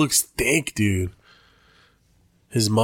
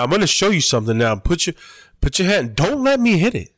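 high-pitched, emphatic warning on each command; imitated fighter's coaching; 6 semitones higher than usual. Fighter showing a partner a punch, high insistent imitation. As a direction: act out a high, insistent sparring instruction. Acts out fighter giving sparring advice.

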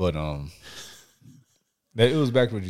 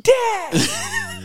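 put-on high-pitched excited yell of a kid; kid yelling Dad; 4 dB louder than usual. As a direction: shriek it like a kid running to the car. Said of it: "Yelling, yelling loud as hell".

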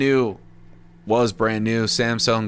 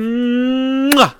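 exaggerated, high-pitched smooch; drawn-out hum into a smacked kiss; big kiss sound; 12 semitones higher, 3 dB louder than usual. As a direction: blow the listeners a noisy kiss. Blows listeners a kiss signing off.

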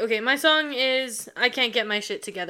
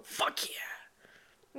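whispered cheer; 15 dB quieter than usual. Real, not acted. Happy with answer.

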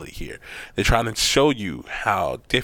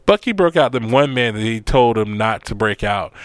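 ordinary delivery

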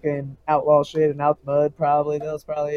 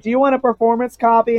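quoting the engineer; 8 semitones higher, 5 dB louder, faster than usual. Retold or imitated. Retelling engineer's question.

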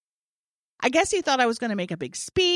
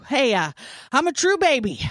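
out of breath; 5 semitones higher, 5 dB louder than usual. Retold or imitated. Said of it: "let me catch my breath".

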